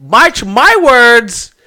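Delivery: high, loud, slow indignant repeat; indignant mock echo. Shopkeeper repeating the threat back to the adventurer. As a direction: throw his words back at him, drawn out.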